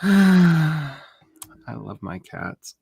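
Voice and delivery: slow, high, dreamy sigh; slow adoring sigh. Real, not acted. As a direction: melt over your cats. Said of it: "sighs, repeats".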